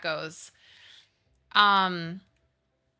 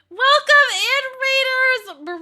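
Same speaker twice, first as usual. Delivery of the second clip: high loud sing-song welcome; excited welcome; 13 dB louder, faster than usual. Real, not acted. Streamer welcoming the raid, high and loud. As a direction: belt it out to greet the raid.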